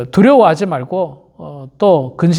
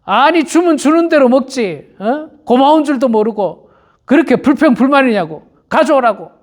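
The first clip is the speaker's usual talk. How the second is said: higher-pitched mock indignant retort; quarrelling back; 5 semitones higher than usual. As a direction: argue back like an offended cook, pitched up. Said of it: "같이 싸우죠". (발끈해서 따지며 싸우는 말투)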